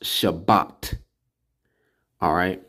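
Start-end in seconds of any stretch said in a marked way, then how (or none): 0.0-1.0 Shabbat said slowly, shah-BAHT with a clear t; teacher modelling the word; careful pronunciation. Shown as a demonstration. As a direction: pronounce Shabbat carefully for the learner. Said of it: "But it's pronounced".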